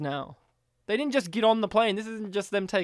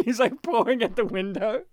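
ordinary delivery

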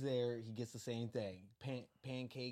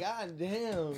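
loud laughing exclamation; storyteller laughing at the chaos; 6 semitones higher, 8 dB louder than usual. Retold or imitated. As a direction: laugh it out in disbelief. Laughing reaction to scene.

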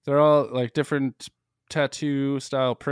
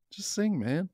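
normal talking voice

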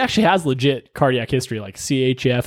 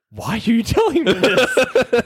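incredulous; 7 semitones higher, slower than usual. Retold or imitated. Medic hears patient's shower story.

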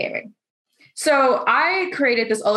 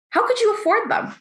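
mocking condescending question; 11 semitones higher than usual. Quoting condescending SEO guy.

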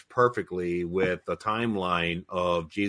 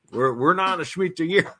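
raised-pitch imitation of a screamer; half-hearted mock scream, pitched up; 7 semitones higher than usual. Host imitating the chat objector tomorrow, mock screaming. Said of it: "think he's gonna be screaming".

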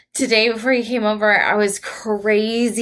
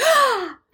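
sharp high gasp; sudden high-pitched gasp, reenacted; imitated pained gasp. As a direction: gasp as if sore legs hit the stairs. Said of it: "I was just like, walking down the stairs".

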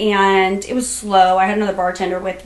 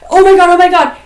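loud, raised, repeating in mock panic; retold panic; 7 semitones higher, 9 dB louder, faster than usual. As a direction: imitate someone frantic and pacing. Retells attacker pacing in panic.

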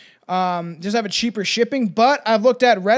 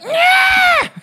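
very high, loud shrieking scream; imitated scream; 8 dB louder than usual. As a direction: let out a piercing shriek. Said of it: "I thought it was like".

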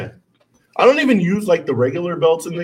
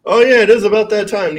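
higher and louder hype; hyped up; 8 semitones higher, 6 dB louder than usual. Real, not acted. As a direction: pump up the room. Hypes up the segment.

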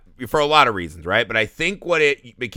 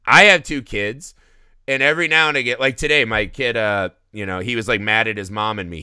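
normal talking voice

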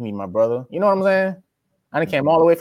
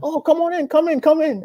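imitating the woman, high and eager; high welcoming host voice; 13 semitones higher than usual. Host mom waving the new tenant inside, high welcoming voice. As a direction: pitch it way up, ushering him in. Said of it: "and she was like".

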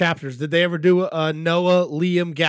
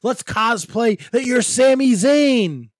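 higher, louder mocking taunts; jeering mock commands; 6 semitones higher, 4 dB louder than usual. Crowd forcing the referee to dance, jeering mock shouts. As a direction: bellow it like a heckler toying with a victim.

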